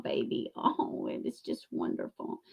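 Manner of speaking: sarcastic hushed praise, trailing to breath; mock sweet praise. Sarcastic coo at Laura, the rival vlogger.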